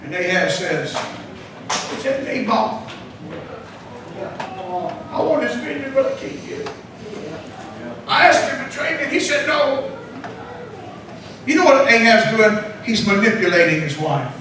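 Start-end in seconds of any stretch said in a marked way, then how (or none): 2.2-9.9 soft, sulky crybaby complaint; lightly acted, pouting self-pity; mock sad sulking. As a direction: play up feeling sorry for yourself, quietly. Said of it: "laying there crying and so sad like this".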